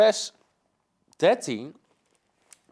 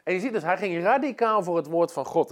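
normal talking voice

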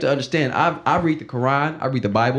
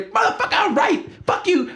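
mock yell; 14 semitones higher than usual. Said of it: "I will yell all day".

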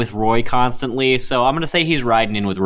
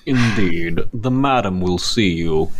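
butler voice; 5 semitones lower, slower than usual. Acted. Butler NPC speaking.

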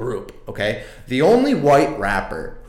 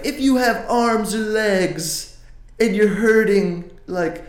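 higher, whiny mock of [name]'s earnest speech; 8 semitones higher than usual. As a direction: parody a syrupy sincere plea. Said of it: "he goes on the Grammys and he's like".

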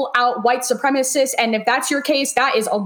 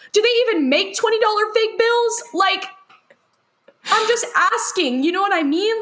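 incredulous; 7 semitones higher than usual. Real, not acted. Rhetorical disbelief about fake bills.

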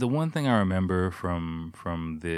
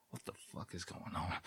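bewildered whisper; whispered confusion; 15 dB quieter than usual. Retold or imitated. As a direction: whisper it, baffled. His confused reaction.

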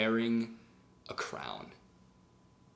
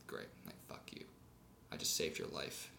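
bitter muttering; 7 semitones lower, 14 dB quieter than usual. Acted. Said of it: "he's kinda bitter".

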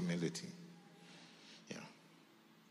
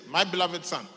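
proclaimed; 6 semitones higher, 8 dB louder than usual. Acted. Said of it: "So it says".

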